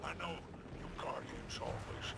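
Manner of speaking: whisper. Acted. Spider slyly tells the Guardian about the gift, whispering. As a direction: sly whisper.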